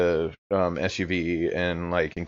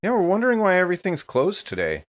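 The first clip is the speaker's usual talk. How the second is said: higher voice; 11 semitones higher, faster than usual. Acted. Player voices character, high fast.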